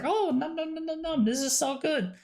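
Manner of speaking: high, soft, mock yummy noises; mock delighted eating. Streamer to chat on the drink recipe, mock yummy cooing. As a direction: coo it like tasting something delicious. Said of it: "and then it's like".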